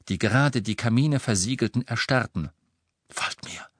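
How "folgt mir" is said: soft unvoiced whisper. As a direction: whisper it to the group. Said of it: "flüsterte er der Gruppe".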